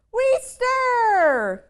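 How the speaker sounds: very high, squeaky little monster voices; little ones crying out. Acted. Wee monsters answer their mother in a high squeak. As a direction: pipe up like tiny monster kids. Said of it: "cried the two".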